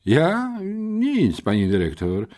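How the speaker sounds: high, startled stammer of denial; choked out. A narrator's reading. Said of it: "wykrztusiłem". (wykrztuszone, spłoszone zaprzeczenie)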